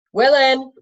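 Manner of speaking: a shouted summons; shouting. A narrator's reading. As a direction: call the name through the open door. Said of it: "Mendenbar shouted".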